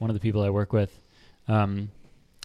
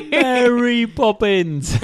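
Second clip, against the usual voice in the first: high, loud, drawn-out Cockney mimicry; mock Cockney; 13 semitones higher, 7 dB louder, much slower than usual. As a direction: laugh through an exaggerated Cockney call. Mimics the Cockney call, laughing.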